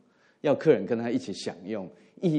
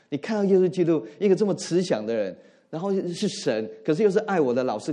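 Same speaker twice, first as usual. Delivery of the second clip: higher and louder, pressing the listeners; fervent preaching; 6 semitones higher, 6 dB louder than usual. Acted. Pastor urges the congregation to imagine Jesus speaking, fervent preaching. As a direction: preach it with rising urgency.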